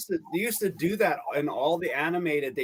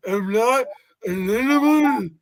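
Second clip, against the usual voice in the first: strained, high, slow pleading; Elephant Man voice; 8 semitones higher, 7 dB louder, slower than usual. Acted. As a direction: cry out to be seen as human. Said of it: "and I played the Elephant Man".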